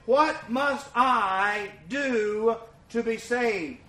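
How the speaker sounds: drawn right out, each word weighed; slow solemn question. Shown as a demonstration. Preacher to congregation, very slow and solemn. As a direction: lay the question down slowly before the church.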